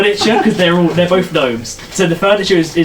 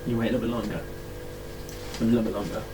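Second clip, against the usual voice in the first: very soft, hushed narration; hushed suspense; 7 semitones lower, 17 dB quieter, much faster than usual. Pretend. Game master keeps a player waiting at the door, hushed. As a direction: draw out the wait in a low hush.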